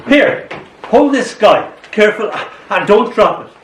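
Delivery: high-pitched, urgent warning; bossy Memory. Acted. Memory hands the poet the sky to hold, urgent warning. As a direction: hand it over with fussy caution.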